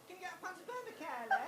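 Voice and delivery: a lightly voiced barroom cry; mock rough girl call. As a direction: mimic her shouting for a pint. Friend's call in the documentary bit.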